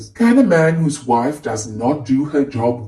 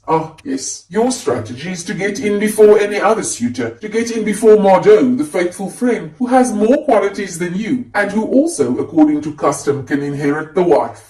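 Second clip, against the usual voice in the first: higher-pitched, biting mockery of a suitor; sarcastic; 6 semitones higher, 3 dB louder than usual. A narrator's reading. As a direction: needle him with mock admiration. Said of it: "sometimes sarcastic".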